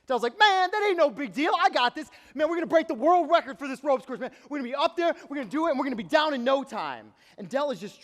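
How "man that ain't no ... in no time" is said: loud, high, swaggering bragging; boastful buddy talking big; cocky trash talk. Retold or imitated. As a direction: brag like you own the course. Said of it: "Dell starts trash talking this ropes course".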